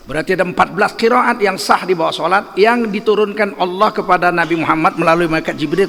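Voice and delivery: usual speaking voice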